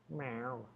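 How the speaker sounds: quiet imitated cat meow; soft meow. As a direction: meow softly at the kitty. Meowing at the cat.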